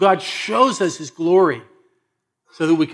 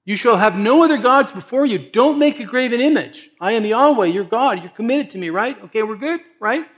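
loud, higher voice of God laying down the law; voicing God; 6 semitones higher, 3 dB louder than usual. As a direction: proclaim each command as God, louder and higher. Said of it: "God is revealing the truth".

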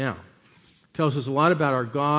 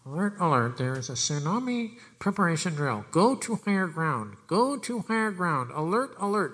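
loudspeaker announcement. Retold or imitated. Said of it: "the plane is actually flying over and it's saying".

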